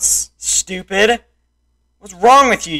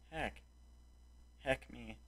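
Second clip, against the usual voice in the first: 1 defeated mutter; 11 semitones lower, 35 dB quieter than usual. Real, not acted.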